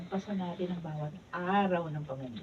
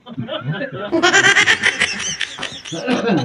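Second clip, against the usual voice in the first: breaking into laughter; 6 semitones higher, 12 dB louder than usual. Real, not acted. Actress breaks up mid-take.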